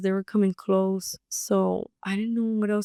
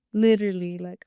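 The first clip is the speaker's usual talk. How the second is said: usual speaking voice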